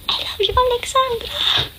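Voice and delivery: put-on high breathy whisper of a bashful boy; shy whispered confession. A neighbor boy whispering his crush to Alexandra. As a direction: whisper it shyly like a kid confessing.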